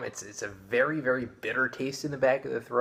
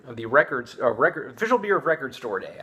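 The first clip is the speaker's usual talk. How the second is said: clearing a scratchy throat; pushing through a throat catch; 2 semitones higher, 5 dB louder than usual. Real, not acted. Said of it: "excuse me, I'm choking on something".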